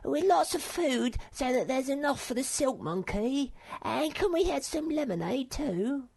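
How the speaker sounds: a small animal asking for treats; Sniff voice. A narrator's reading.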